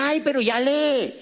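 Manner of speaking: playful imitation of an amused admirer; imitated amused delight. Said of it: "Entonces se reía".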